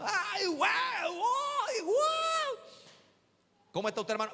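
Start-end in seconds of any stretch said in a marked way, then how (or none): 0.0-2.6 much higher, hammed-up yelping cry; mock cry. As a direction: yelp it out like a hammed-up cry of alarm. Acted shouting in the skit.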